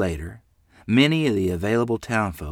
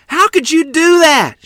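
high, loud, drawn-out outrage; angry yell; 14 dB louder, slower than usual. A narrator's reading. Mother to child who lost the money, yelling angrily. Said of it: "she yelled".

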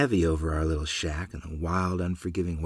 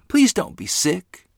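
desperate plea; 11 semitones higher, 6 dB louder, slower than usual. A narrator's reading. Boy begs his sick mother.

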